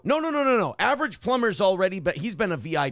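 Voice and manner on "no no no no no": rapid no no no, pitched up; emphatic correcting no's; quick denial. Real, not acted. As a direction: cut off the wrong idea fast. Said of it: "correcting a chat misreading".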